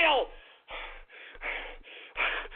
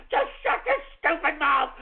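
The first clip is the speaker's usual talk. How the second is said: normal talking voice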